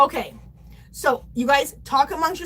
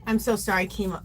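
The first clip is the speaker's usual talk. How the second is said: ordinary delivery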